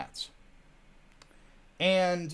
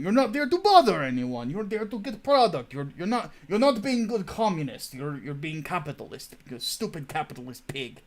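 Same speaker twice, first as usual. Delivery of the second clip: mock Russian accent; 3 dB louder than usual. Host jokes as a Russian hat seller, in a thick mock Russian accent. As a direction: play a comic Russian shopkeeper in a thick Russian accent. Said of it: "Okay, enough of the Russian".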